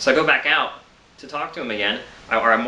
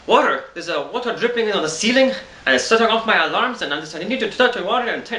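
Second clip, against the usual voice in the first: neighbor's voice; 10 semitones higher than usual. Retold or imitated. Imitating the panicked neighbor shouting about water dripping. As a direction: play the frantic neighbor at the door, pitched up and pushing. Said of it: "I'm trying to do his voice".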